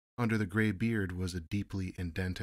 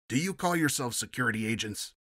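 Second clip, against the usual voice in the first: higher, louder furious captain; shouting; 5 semitones higher, 5 dB louder than usual. A narrator's reading. Captain yells at the security agents, furious shout. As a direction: blast them with contempt. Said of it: "he shouted".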